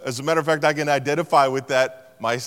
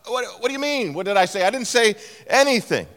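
defensive surprise; 7 semitones higher, faster than usual. Retold or imitated. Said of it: "the other person responds".

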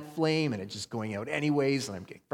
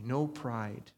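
ordinary delivery

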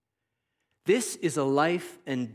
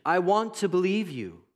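blurted confession; 5 semitones higher than usual. Acted. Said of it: "the father blurts out an honest and humble confession".

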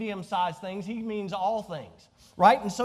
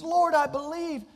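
normal talking voice

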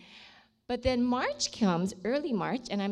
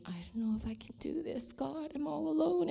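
despairing whisper; 6 semitones higher, 5 dB quieter than usual. Retold or imitated. Despairing prayer in dark place.

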